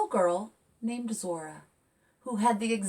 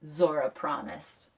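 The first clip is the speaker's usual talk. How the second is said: lively picture-book read; reading to children; 3 dB louder than usual. A narrator's reading. Author reading her picture book aloud to kids, lively. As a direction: read it bright and expressive.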